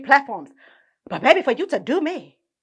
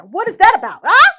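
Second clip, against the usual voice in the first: louder, rising, pushy question; nosy excitement; 6 semitones higher, 9 dB louder than usual. Real, not acted. Teasing about the hot questions, loud and nosy. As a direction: press for the juicy gossip.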